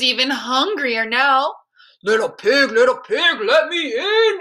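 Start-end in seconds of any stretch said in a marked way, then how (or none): 2.0-4.4 big bad wolf calling out, rising loud on the demand; growling wolf. A narrator's reading. Hungry wolf demands that the little pig open up, growling. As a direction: growl it like a storybook wolf at the door. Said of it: "he growled".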